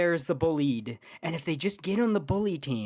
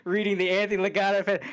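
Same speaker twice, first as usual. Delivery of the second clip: usual speaking voice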